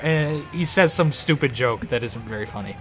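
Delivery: ordinary talk, nothing marked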